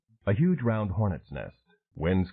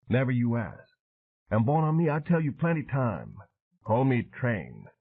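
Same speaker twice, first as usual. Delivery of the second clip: higher, rough-edged bayou man telling war story; matter-of-fact old sailor recalling the ambush; weathered Cajun veteran; 4 semitones higher than usual. Acted. Train telling Dan how his crew died, voiced.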